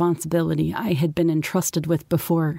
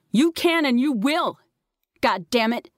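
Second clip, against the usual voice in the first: high, emphatic motherly command; insist, allowing no argument; firm order ending in a curse; 7 semitones higher than usual. A narrator's reading. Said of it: "she ordered".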